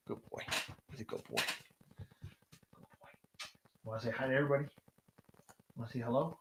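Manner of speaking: hushed tender dog talk; soft pet talk. Real, not acted. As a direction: gently comfort your dog.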